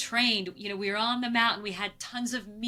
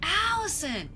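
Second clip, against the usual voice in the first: a high call across the ridge, retold; distant calling out; 10 semitones higher than usual. Mountain guide calling up to a climber, high retold call. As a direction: call her name up high to someone far off. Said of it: "I hear this voice saying".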